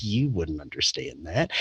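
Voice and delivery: low, soft otherworldly reply; retold entity voice. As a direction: drop low and quiet as the mysterious being.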